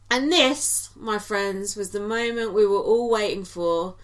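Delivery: ordinary talk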